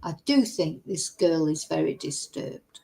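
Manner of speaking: ordinary delivery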